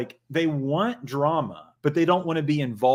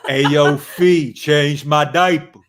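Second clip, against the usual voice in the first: put-on slow, louder old-man voice with a laugh; mock old man; 8 dB louder, slower than usual. Host teases Jim as old man, slow laughing voice. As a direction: croak it out like a needy old man. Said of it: "He's like".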